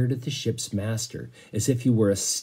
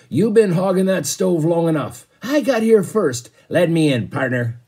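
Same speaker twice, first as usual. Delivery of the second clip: loud, higher rough shove for the stove; crowd shouting; 7 semitones higher, 6 dB louder than usual. Acted. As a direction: shout it at the man hogging the heat. Passengers shoving for the stove.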